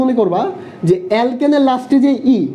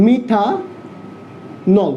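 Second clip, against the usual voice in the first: slowly drawn out; 2 semitones lower, 3 dB quieter, much slower than usual. Shown as a demonstration. Teacher drawing out methanol very slowly for the class. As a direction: stretch methanol out very slowly, syllable by syllable. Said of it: "দেখো".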